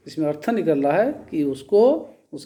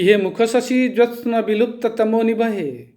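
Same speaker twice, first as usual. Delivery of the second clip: husband's verse recited; 6 semitones higher than usual. Retold or imitated. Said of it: "या पति कह रहा है".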